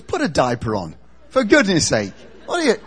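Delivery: loud mock exasperation; exasperated rebuke. Joseph waking to a flying baby angel, exasperated. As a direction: scold loudly, half laughing.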